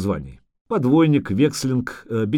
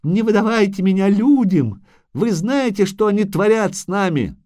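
high, louder desperate pleading; terrified creature crying for mercy; heart-rending wail; 10 semitones higher, 4 dB louder than usual. A narrator's reading. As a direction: beg in a wailing high voice. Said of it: "душераздирающе завопил Доплер".

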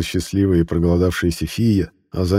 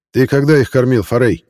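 merchant voice; 6 semitones higher, 5 dB louder than usual. Acted. Trader calls his son.

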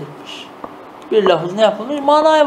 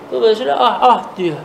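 ordinary talk, nothing marked

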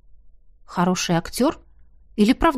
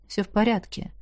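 quiet reassurance; 7 dB quieter than usual. Acted.

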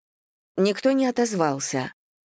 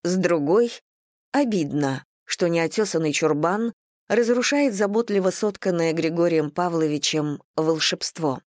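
ordinary delivery, nothing marked

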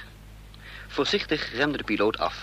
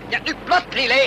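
much higher and louder, tense command; urgent shout; 11 semitones higher, 4 dB louder than usual. Acted. Driver to Lillet as pursuers close in: urgent shout. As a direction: yell at her to get down now. Ordering Lillet down as chase nears.

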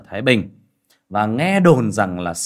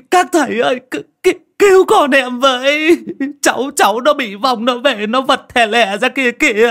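wailing cry for help; 10 dB louder than usual. Acted. Mother bursting in begging the clan head, high loud wail. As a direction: scream for help as if your child is possessed. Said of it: "bà đã kêu rầm rầm trời lên".

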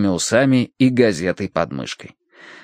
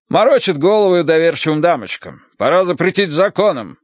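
higher, indignant short sentences; chopped phrases; 7 semitones higher, 3 dB louder than usual. A narrator's reading. Colonel rails at spiritualist frauds, chopped phrases. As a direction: rail in curt chunks. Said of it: "разговаривал рубленными фразами".

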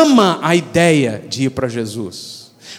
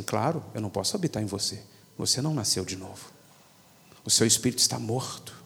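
lower, softer calm divine reply; voice of God; 8 semitones lower, 12 dB quieter, faster than usual. Retold or imitated. God gently correcting the believer, low and soft. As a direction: answer gently, as God setting things straight.

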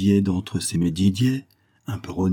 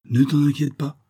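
usual speaking voice

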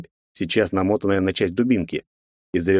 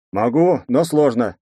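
muffled, as if through a helmet; muffled from under helmet; 4 semitones higher, 3 dB louder, slower than usual. Acted. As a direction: speak from inside a closed helmet. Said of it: "раздался глухой голос из-под шлема". (глухо, из-под шлема)

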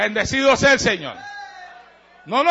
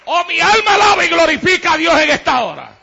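shouting, higher, louder sermon climax; preaching at full force; 5 semitones higher, 7 dB louder than usual. Acted. Preacher to the congregation, louder, higher, full force. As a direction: hammer the line home from the pulpit.

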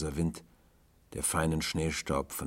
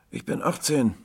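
put-on casual remark in a higher boy's voice; 10 semitones higher, 6 dB louder than usual. A narrator's reading. Boy telling tramp his age, casually. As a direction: toss it off, high and louder. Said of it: "sagte er beiläufig".

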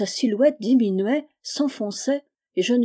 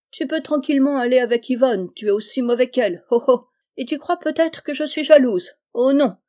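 high, loud, scornful dismissal; mock them with put-on laughter and denial; hateful taunt; 5 semitones higher, 5 dB louder than usual. Jealous girl sending him off to Yvonne, scornfully defiant.

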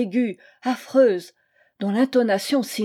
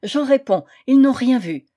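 louder, higher, emphatic assurance; insistent; 3 semitones higher, 7 dB louder than usual. A narrator's reading. Flustered priest vouching for his pupils, insistent. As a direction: insist ever more loudly. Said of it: "il répétait".

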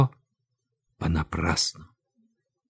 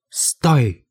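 higher-pitched halting call; loud call; 5 semitones higher than usual. A narrator's reading. Loud voice halting an execution, a mild shout. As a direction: call out a halt, not at full volume. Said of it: "кричит громкий голос".

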